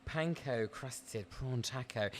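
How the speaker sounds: soft, slow, savoured food-ad read; M&S advert voiceover. Acted. Host reading out the afternoon tea menu, M&S advert style. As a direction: murmur it slowly like a luxury food advert. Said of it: "We're doing it M and S style".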